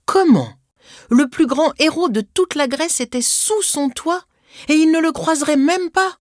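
high-pitched outraged protest; aggrieved, high-voiced disbelief; indignant child's anger. A narrator's reading. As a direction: protest the unfairness like a small boy. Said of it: "se transformait en colère".

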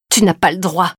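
contained outburst of indignation; fuming, holding tears. A narrator's reading. Woman who just slapped her, fuming. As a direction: protest angrily on the edge of tears. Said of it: "fulmina Tiphaine en retenant ses larmes".

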